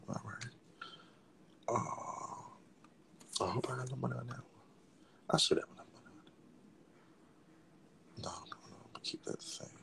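hushed whisper to herself; whispering while booking a flight. Real, not acted. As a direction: whisper it under her breath.

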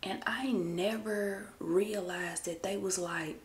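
breathy whisper, barely voiced. Real, not acted. Mom whispering so sleeping Karen stays asleep, hushed whisper. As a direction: keep it hushed so she doesn't wake.

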